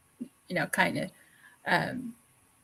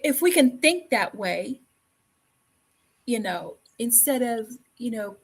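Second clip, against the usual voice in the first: ordinary talk, nothing marked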